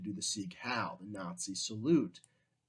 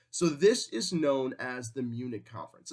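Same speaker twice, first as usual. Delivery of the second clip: normal talking voice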